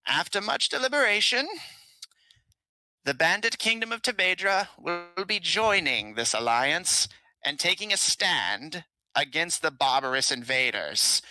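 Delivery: high reedy king making a proud announcement; frail old king. Acted. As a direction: voice a pleased old king declaring. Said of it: "smiles and says".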